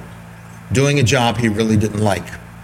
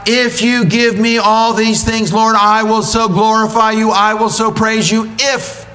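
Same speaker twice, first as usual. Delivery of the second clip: greedy cat praying; 8 semitones higher, 3 dB louder than usual. Acted. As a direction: pray for blessings like a spoiled pet. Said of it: "desire to see God glorified".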